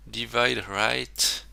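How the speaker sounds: very slow English "Divide right" in a French accent. Shown as a demonstration. Coder reading a CSS rule aloud, slow French-accented English. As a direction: spell out "Divide right" slowly with French vowels. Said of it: "vous adorez mon accent".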